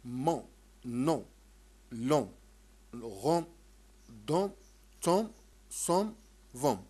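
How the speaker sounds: "مون" "نون" said loud with a faint final ميم; light m drill. Shown as a demonstration. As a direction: drill each ending as a light, unclosed ميم. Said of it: "ميم خفيفة". (تكرار بميم خفيفة)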